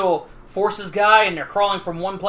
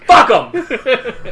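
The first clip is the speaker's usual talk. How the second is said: loud, raised laughing curse; laughing yell; 7 semitones higher, 7 dB louder than usual. Acted. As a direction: bark it out through laughter. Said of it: "you just blew out the mic".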